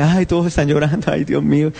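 half-hearted imitation of tearful moaning; mock wailing. Preacher mimicking contagious crying to the congregation, mock wailing.

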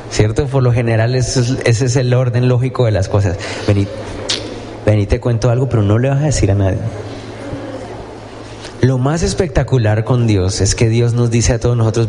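ordinary delivery

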